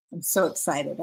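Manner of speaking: normal talking voice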